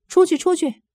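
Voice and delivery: shouted high and loud, ordering her out; harsh shout. Retold or imitated. Policeman loudly ordering the woman to leave, harsh high shout. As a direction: bark it like a cop throwing someone out. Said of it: "他就大声厉害地说".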